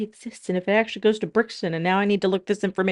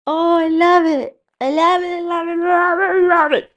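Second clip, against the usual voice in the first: louder, higher repeated delight; gushing; 9 semitones higher, 7 dB louder than usual. Real, not acted. Admiring her finished double-decker bus, gushing. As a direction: gush over it again and again.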